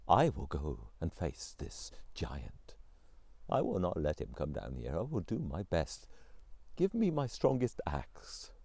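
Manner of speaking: resolute Tsar. A narrator's reading. Tsar telling his wife he will fight, low resolve. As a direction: calmly settle that you must go.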